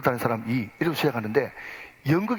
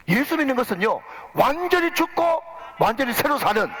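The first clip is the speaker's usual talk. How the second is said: full preaching; 7 semitones higher than usual. Acted. Pastor driving home the sermon's point, fervent preaching. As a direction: build to a ringing climax.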